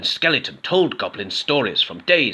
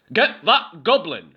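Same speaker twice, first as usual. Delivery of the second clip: higher, slow, loud shout; 6 semitones higher, 4 dB louder, slower than usual. A narrator's reading. The mob chasing Goblin, slow loud shout. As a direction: yell after the goblin. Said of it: "shouted everyone".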